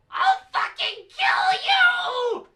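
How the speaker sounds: high, slow, furious scream. Acted. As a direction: scream the threat at full force. Freed hostage's first words.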